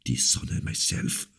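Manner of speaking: whispered in cold anger; murmured. Acted. The king to La Valliere, a pale angry whisper. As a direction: breathe it out, barely audible. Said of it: "murmured the king".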